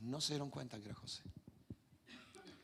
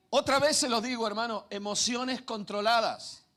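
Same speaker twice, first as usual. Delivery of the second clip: higher, louder preaching push; fervent preaching; 6 semitones higher, 3 dB louder than usual. Acted. Preacher urging the congregation to control emotions, fervent. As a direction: drive the point home to the flock.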